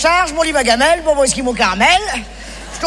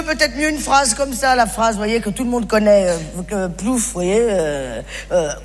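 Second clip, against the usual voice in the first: usual speaking voice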